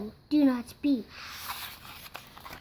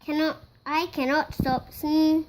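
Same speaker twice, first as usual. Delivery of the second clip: usual speaking voice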